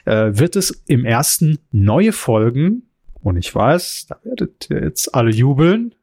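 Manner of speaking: normal talking voice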